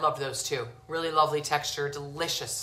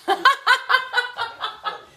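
squealing laugh, much higher; high burst of laughter; 5 dB louder than usual. Real, not acted. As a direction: laugh high at your own blunder. Pans back in, boiling hot.

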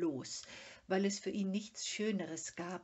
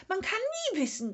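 high, loud, fast shout; 13 semitones higher, 9 dB louder, much faster than usual. A narrator's reading. Sniff shouting warnings at Mumin, high, loud and fast. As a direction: keep yelling the warning. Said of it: "schrie das Schnüfferl".

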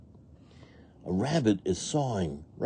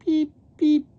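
two tiny, very high cheeps; baby duck peeps; 4 dB louder than usual. A narrator's reading. Mama duck's babies answering, high peeps. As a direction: squeak like little ducklings. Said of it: "her babies say".

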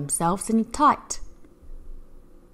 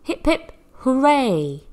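cheer; 6 semitones higher than usual. Acted. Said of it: "Let's give them a cheer".

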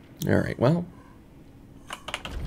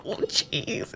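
whisper; 4 semitones higher, 13 dB quieter than usual. Real, not acted. Rising water in game.

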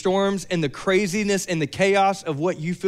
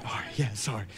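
much lower, softer mumbled sorry; sheepish apology; 10 semitones lower, 12 dB quieter than usual. Retold or imitated. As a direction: back down meekly. Sheepish reply after rebuke.

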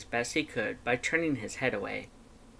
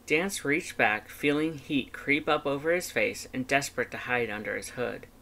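ordinary delivery, nothing marked

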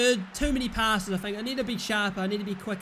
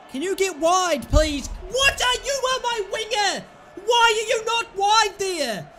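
higher and louder, berating the winger; exasperated shouting; 8 semitones higher, 5 dB louder than usual. Real, not acted. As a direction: shout him back out wide. Yelling at winger.

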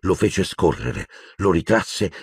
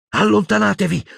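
high, loud command; sharp raised order; 3 dB louder than usual. A narrator's reading. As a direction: order them back. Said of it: "ordinò Hermione".

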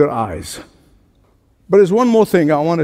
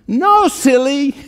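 higher, slow, teasing "No, silly"; playful mock retort; 8 semitones higher, slower than usual. A preacher answering a news reporter, higher and teasing. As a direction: tease the TV reporter, half laughing. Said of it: "I want to shout".

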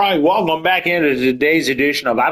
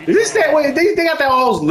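surprised. Real, not acted. Surprised at lineup.

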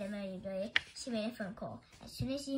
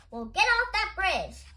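loud, high, drawn-out order; exasperated, high and slow; exasperated retort; 10 semitones higher, 13 dB louder, slower than usual. A narrator's reading. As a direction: raise your voice and stretch it out. Exasperated reply to a caller.

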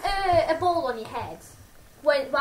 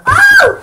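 sour candy yelp; 13 dB louder than usual. Real, not acted. Sour sweet reaction.

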